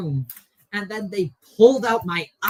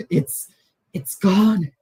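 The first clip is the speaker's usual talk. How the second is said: relieved; 5 semitones higher, 7 dB louder, slower than usual. Acted. Freed soldier voiced by GM.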